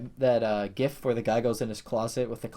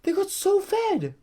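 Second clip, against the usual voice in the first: much higher and louder, gushing; bursting with glee over his picks; excited; 13 semitones higher, 5 dB louder than usual. Real, not acted. Fan bragging about his fantasy picks, excited and much higher.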